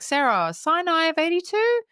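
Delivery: high-pitched greeting, rising at the end; feigned recognition. Retold or imitated. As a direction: greet a stranger like an old acquaintance. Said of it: "as if she knows her".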